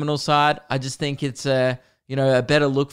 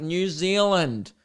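drawn-out, higher, unmuffled NEW ZEALAND; slow and clear; 4 semitones higher, much slower than usual. Retold or imitated. Customer with mask moved aside, slow and clear. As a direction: spell out New Zealand slowly for someone who misheard. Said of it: "I had to move a mask".

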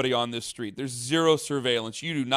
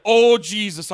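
Donnie impression; 7 semitones higher, 8 dB louder, slower than usual. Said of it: "I felt like Donnie in Big Lebowski".

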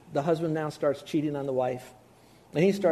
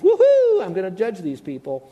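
mock whoop; 3 semitones higher, 6 dB louder than usual. Preacher mocking the idea of God gleefully judging, whooping loud. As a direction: whoop like a kid at a party.

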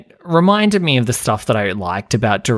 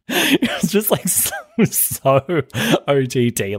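laughing; 4 semitones higher, slower than usual. Real, not acted. Laughing at the scene.

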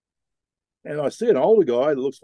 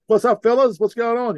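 higher, louder friendly call across the water; 11 semitones higher, 6 dB louder than usual. Retold or imitated. Captain hails the locals at the stern, friendly higher call. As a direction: hail the fellas casually. Calling out to the men.

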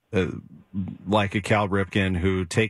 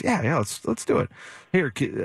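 eager Cal; 5 semitones higher than usual. Retold or imitated. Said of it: "He goes".

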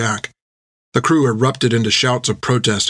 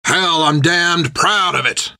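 growling; 6 semitones higher, slower than usual. A narrator's reading. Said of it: "Jack growled back".

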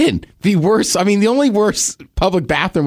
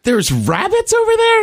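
incredulous, pitched way up; disbelieving echo. Real, not acted. Host echoing the rabbit story in high disbelief. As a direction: repeat it like you can't believe it.

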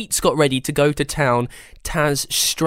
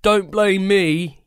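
slow, sleepy yawned words; yawning; 7 semitones higher, slower than usual. Acted. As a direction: say it mid-yawn. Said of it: "Yasmin yawned".

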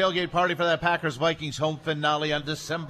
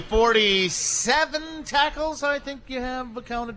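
high, loud radio welcome-back; old radio announcer; 7 semitones higher, 4 dB louder than usual. Acted. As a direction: boom it out like a radio host. Host opens after break.

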